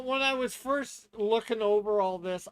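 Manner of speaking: ordinary talk, nothing marked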